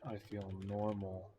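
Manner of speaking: soft, halting meme recital; meme quote. Acted. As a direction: recite it quietly, spacing out each phrase. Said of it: "Search the meme up".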